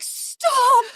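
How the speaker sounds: high, sharp cry of stop; alarmed stop. Retold or imitated. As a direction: stop her mid-snip. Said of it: "And I'm like".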